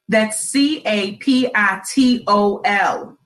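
C A P I T O L, each letter slow and separate; spelled out slowly. Shown as a demonstration. Host correcting listeners' spelling, slow letter by letter. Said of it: "Here's your B tip of the week".

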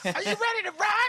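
high rock star shout; [name] voice. Pretend. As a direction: belt it like a stadium frontman. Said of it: "a moment of chaos as [name]".

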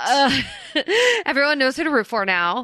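talking through laughter; laughing. Real, not acted. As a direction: laugh while getting words out. Laughing after being mimicked.

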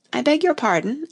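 Alice confused by the word unbirthday; puzzled. A narrator's reading. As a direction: ask as if lost. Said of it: "with a puzzled air".

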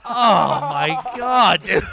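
laughing in disbelief; cracking up mid-word. Real, not acted. Laughing at the one-hand clap claim.

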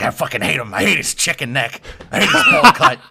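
hammed-up ranting voice that shoots way up on bowl cut; mock a conspiracy host losing it over a haircut; Alex Jones rant. Podcaster imitating a TV host ranting about a billionaire, mock rant. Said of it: "And he's like".

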